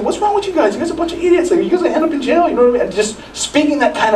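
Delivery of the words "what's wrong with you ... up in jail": put-on high, harsh parent berating the kids; a hammed-up scolding parent; mock scolding parent. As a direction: imitate a parent tearing into their kids.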